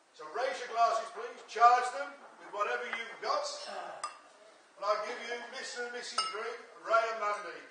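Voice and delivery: loud toast called to the room; wedding toast speech. Real, not acted.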